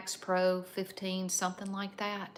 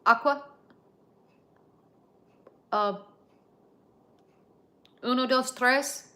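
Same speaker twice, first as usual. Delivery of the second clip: loud, high beginner's Spanish; Spanish words; 5 semitones higher, 8 dB louder than usual. Shown as a demonstration. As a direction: proudly show off a little Spanish. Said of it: "How is your Spanish?".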